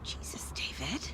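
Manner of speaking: hushed, breathless shock; shocked whisper. Acted. Mother hearing her daughter could have drowned, hushed shock. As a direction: whisper it, stunned by the news.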